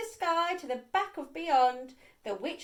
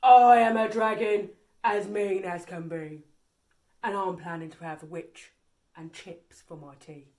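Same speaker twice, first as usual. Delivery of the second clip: lower, loud menacing dragon voice; scary dragon; 7 semitones lower, 7 dB louder than usual. A narrator's reading. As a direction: growl like a hungry dragon. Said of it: "a roar that was scary and loud".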